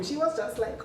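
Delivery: usual speaking voice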